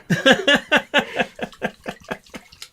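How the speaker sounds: long, high laugh fading out; real laughter still rolling. Real, not acted. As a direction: keep laughing as it winds down. Long laugh after story.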